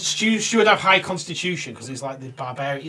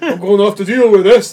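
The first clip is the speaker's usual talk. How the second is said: louder hammed-up cop line, rising on this; mock tough cop; 4 semitones higher, 11 dB louder than usual. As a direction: play the TV cop like an action hero. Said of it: "And he's like".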